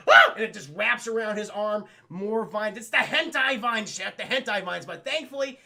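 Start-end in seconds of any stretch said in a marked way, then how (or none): 0.1-0.3 short mock scream; sudden yelp of alarm; startled yelp. Batman caught by a vine, startled yelp.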